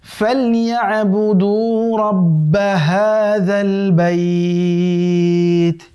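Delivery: slow, drawn-out melodic tilawat; Quran recitation. Shown as a demonstration. Teacher recites the verse for students in slow melodic chant. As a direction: chant the verse slowly with long held vowels. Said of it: "তৃতীয় আয়াত".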